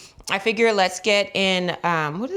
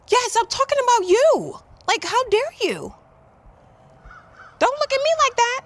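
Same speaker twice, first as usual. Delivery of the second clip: very high squeaky talk to the dog; high pet voice. Real, not acted. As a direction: scold the puppy in a squeaky voice. Addressing the dog directly.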